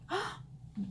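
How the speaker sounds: a quick, high, flattered gasp; mock gasp. Reacting to the stranger's compliment with a high gasp. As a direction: gasp as if charmed.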